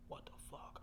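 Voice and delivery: whisper. Retold or imitated. Woken by toilet, whispered.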